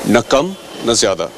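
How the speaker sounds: usual speaking voice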